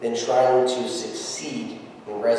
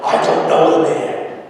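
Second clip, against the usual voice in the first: cursing denial; 3 semitones higher, 7 dB louder than usual. Retold or imitated. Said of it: "call down curses and swore".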